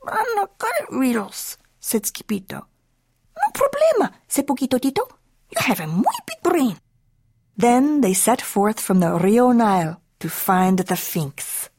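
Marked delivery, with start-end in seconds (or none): 0.1-1.6 small, very high kitten voice; reluctant kitten. Acted. Skipito to Don Diego, reluctant. 3.4-6.8 very high-pitched little pup voice; tiny Chihuahua. Acted. Poquito Tito encouraging Skipito, squeaky.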